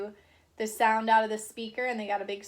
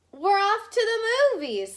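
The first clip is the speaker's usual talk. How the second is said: much higher and louder, gleeful; cheerful announcement; 10 semitones higher, 6 dB louder than usual. Acted. Husband telling the neighbor, high and gleeful. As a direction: sing out the plan.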